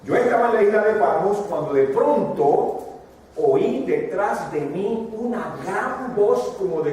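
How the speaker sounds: apostle's words, preached with force; voicing the apostle John. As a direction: tell it as John, awed by the trumpet voice. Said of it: "dice el apóstol Juan".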